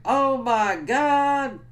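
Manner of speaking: high, loud, drawn-out exclamation; mock horrified wail. [name] seeing the head ripped off, mock horrified. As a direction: stretch it out in acted horror.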